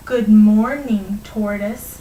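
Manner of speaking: drawn-out, mock-slow greeting; mocking slowness. Hare to tortoise, mocking his speed, very slowly. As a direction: stretch the words out to mock a slow talker. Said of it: "said the hare very slowly, mocking the tortoise".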